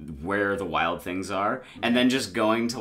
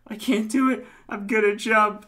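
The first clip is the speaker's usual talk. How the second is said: put-on much higher, pleading girl voice; mock girl voice; 12 semitones higher, 4 dB louder than usual. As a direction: squeak it out like a panicked girl. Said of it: "a girl going".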